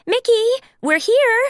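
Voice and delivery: high, loud call through the house. Acted. Minnie calls to Mickey on arrival. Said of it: "Minnie called".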